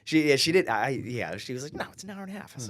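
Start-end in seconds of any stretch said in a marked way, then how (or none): 1.7-2.5 higher, lightly voiced wife correcting him; a hint of the wife setting it straight; wife correcting the distance, mild mock voice; imitating the wife.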